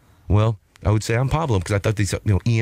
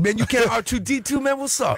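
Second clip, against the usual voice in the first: high character voice; 11 semitones higher than usual. Retold or imitated. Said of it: "that whistle".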